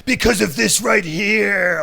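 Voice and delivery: slow, booming wrestling promo growl; pro wrestler voice. Acted. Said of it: "Oh, what kind of voice was that?".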